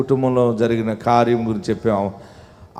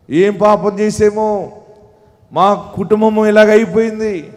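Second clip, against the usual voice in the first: lamenting voice; 9 semitones higher, 6 dB louder than usual. Acted. Pastor voicing a despairing believer's lament, rising to a loud wail. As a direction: build a grieving listener's complaint to a wail.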